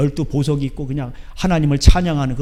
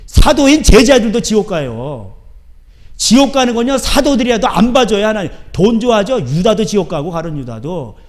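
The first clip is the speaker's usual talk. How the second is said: higher, loud thundering sermon; full-force pulpit preaching; fiery preaching; 5 semitones higher, 6 dB louder than usual. Acted. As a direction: preach it hard.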